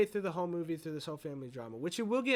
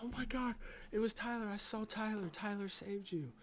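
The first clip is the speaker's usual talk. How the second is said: high-pitched imitation of an amazed mom; astonished mother; 8 semitones higher than usual. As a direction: exclaim in awed disbelief, voice lifted high. Acting mom seeing her dead son.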